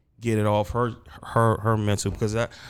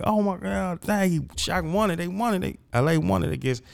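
high-pitched reliving of a win; retold excitement; 10 semitones higher than usual. Fan relives watching his team's championship, retold excitement.

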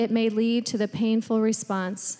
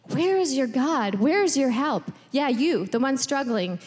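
higher, needling inner accuser; accusing voice of self-doubt, pitched up; taunting; 3 semitones higher than usual. Acted. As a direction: jab each question like a taunt. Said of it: "that taunting inside rages".

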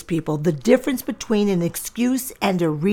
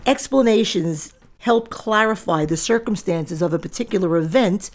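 ordinary talk, nothing marked

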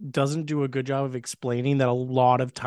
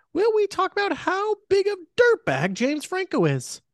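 high silly voice. Acted. High silly voice in plot read.

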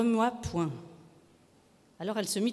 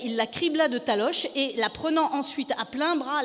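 ordinary delivery, nothing marked

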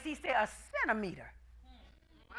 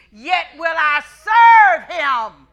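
shouted; 12 dB louder, much slower than usual. Acted. Preacher quoting Job, rising.

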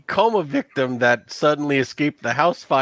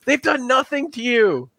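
pleading NPC; 10 semitones higher than usual. Acted. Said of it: "He goes".

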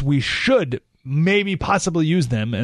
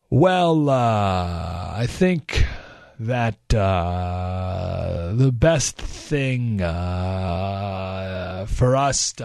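slow, droning, lower with long uh pauses; boring politician; 7 semitones lower, much slower than usual. Retold or imitated. Eisenhower dodging a press question, slow droning ramble. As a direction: drag it out to bore them. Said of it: "He bores you to death".

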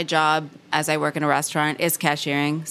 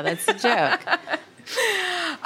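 laughing at the joke; 11 semitones higher, much slower than usual. Real, not acted. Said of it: "I sound like fucking Daria".

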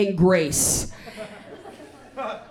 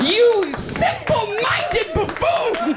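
hammed-up indignant scolding, slow; mock outrage; 6 semitones higher, slower than usual. Comic scolding his friend over penis placement, hammed-up outrage. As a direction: pretend to be deeply offended.

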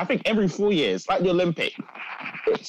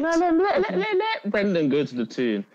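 high, laughing mock chant of let; giggling as he winds his mate up; laughing tease; 12 semitones higher than usual. Teasing the Newcastle fan about Brendan, laughing.

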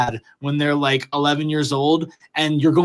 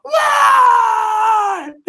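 very high shout, as if from far off in the house; distant yell; 4 dB louder than usual. Retold or imitated. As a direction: yell it like it comes from deep in the background. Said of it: "deep in the background, you'd hear".

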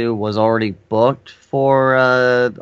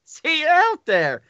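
much higher, nervous little squeak; squeaky voice; 3 dB louder than usual. Retold or imitated. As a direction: squeak it out like a rattled interviewer. Intimidated interviewer after brutal answer.

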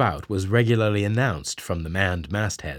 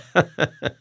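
hearty laugh; 9 semitones higher than usual. Acted. Stubb laughing at the wrecked boat, hearty laugh. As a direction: laugh at your own joke. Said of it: "that laughs".

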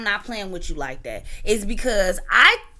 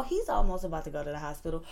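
quick low aside; 7 semitones lower, 8 dB quieter, faster than usual. Retold or imitated.

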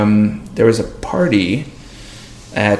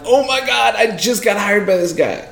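high-pitched mock panic; retold dismay; 11 semitones higher than usual. New hire learning his manager got fired, high mock dismay. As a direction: replay the shock in a high, stretched voice.

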